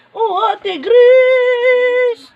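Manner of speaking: long, high call to Ate Grace. Acted. Calling Ate Grace from the next room, long and high. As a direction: stretch the name as a call across the house.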